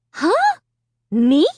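high, loud startled shriek. Acted. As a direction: shriek it in disbelief. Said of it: "I shrieked".

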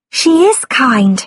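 put-on high, polite little girl; young girl voice. A narrator's reading. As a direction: speak as a shy polite girl. Girl character voice.

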